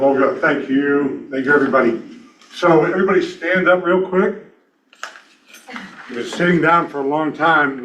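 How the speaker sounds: ordinary delivery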